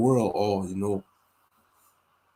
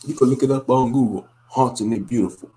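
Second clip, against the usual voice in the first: ordinary delivery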